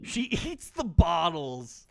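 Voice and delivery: slow words broken by a laugh. Real, not acted. Streamer laughing at a survivor eating bottles. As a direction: laugh through the line in disbelief. Laughing at the player's odd item.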